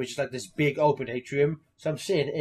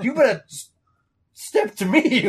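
higher, louder stammered threat; stuttering threat; 8 semitones higher, 6 dB louder than usual. Retold or imitated. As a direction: stumble over the threat, higher. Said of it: "when she's like".

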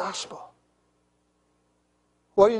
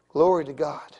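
ordinary talk, nothing marked

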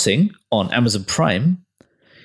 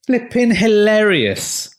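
excited gush; 11 semitones higher, 4 dB louder, slower than usual. Real, not acted. Raving about the show.

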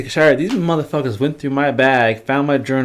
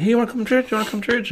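mimicked invitation; 12 semitones higher, 3 dB quieter, much faster than usual.

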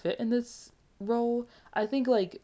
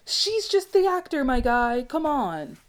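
high and louder, pleading for the actor; exasperated outburst; 6 semitones higher, 6 dB louder than usual. Real, not acted. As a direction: throw your hands up defending her. Defending actress, exasperated outburst.

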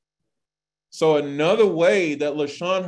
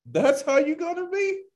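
mock sass; 10 semitones higher than usual. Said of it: "And Hashem goes".

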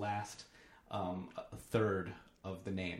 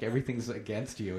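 ordinary talk, nothing marked

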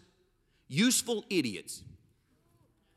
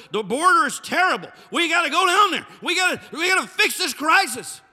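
louder, higher mock panic over the border; mock alarm; 9 semitones higher, 7 dB louder than usual. Preacher mocking past border outrage, loud mock panic. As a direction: ham up the crisis as people cried it then.

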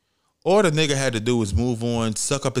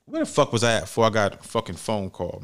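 ordinary talk

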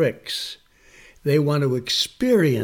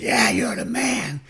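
imitated crowd of kids, rough cheer. Kids hail magician at school show, imitated cheer. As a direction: lightly mimic kids cheering their hero. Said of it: "And they said".

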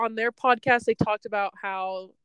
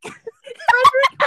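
laughing through the name; breaking into laughter; 13 dB louder, slower than usual. Real, not acted. Laughing at friend's mix-up.

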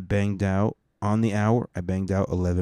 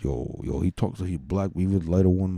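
ordinary talk, nothing marked

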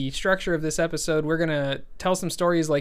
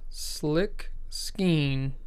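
lips barely moving; 3 dB quieter than usual. Shown as a demonstration. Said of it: "Let me try it".

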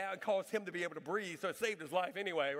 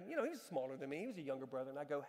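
ordinary talk

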